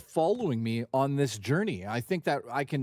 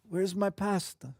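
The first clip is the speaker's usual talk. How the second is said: slow, higher cartoon-Italian whine; mock Italian accent; 4 semitones higher than usual. Streamer cracks himself up demanding pasta, mock Italian accent. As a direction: stretch it out in a silly Italian accent. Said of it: "The accents are killing me".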